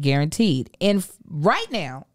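normal talking voice

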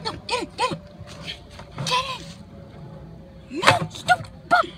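ordinary delivery

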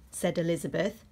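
ordinary delivery

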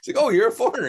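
light up as if it all makes sense; mock sudden realization. Boss realizes he's foreign.

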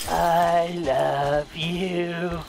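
very slow, low monster voice; creepy killer. Pretend. Killer stalking the hidden player, creepy slow low threat. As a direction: draw each word out low and menacing.

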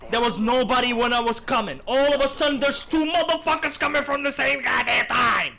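loud rant building into a high shout; real indignant gamer rant; outraged protest. Real, not acted. Protests being ambushed.

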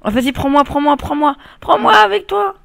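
high, loud begging voice; pleading. Pretend. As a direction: beg in a squeaky childlike plea. Begging friend to take him.

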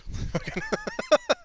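high cackling laugh; burst of laughter. Real, not acted. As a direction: let a real laugh break out. Laughing at the bouncer scene.

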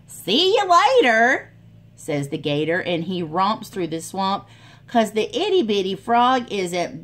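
lively storybook voice for little ones; animated read-aloud for kids; reading to children. A narrator's reading. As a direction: read it like a picture book at story time. Reads picture book aloud.

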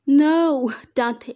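loud, high, long cry of horror; scream. A narrator's reading. Mother screams as her son falls shot, screaming. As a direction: scream out for your child. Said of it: "she screamed".

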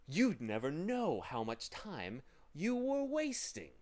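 lightly voiced villain, drawing out key words; scheming demon, gloating. A narrator's reading. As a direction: lecture with sly menace, swelling on the grievance. Villain lectures Milo in character.

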